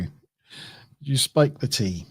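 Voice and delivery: slow, soft, lightly voiced Andrew; drawn out, a hint of mimicry; quoting Andrew slowly. Repeating Andrew's question to the viewers, slow and soft. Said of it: "says Andrew".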